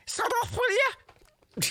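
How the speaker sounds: very high-pitched Scouse squawk; high, strained Scouse voice; Scouse Jimmy. Acted. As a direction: squeal it out as a cartoonish Liverpudlian. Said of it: "It's Scouse Jimmy".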